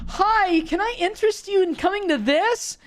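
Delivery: very high, loud mock-peppy solicitor; campus recruiter pitch. Mimicking students who stop passers-by at tables, high mock sales pitch. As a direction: imitate a pushy flyer-hander in a squeaky high voice. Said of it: "It's like that".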